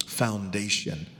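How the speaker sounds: ordinary talk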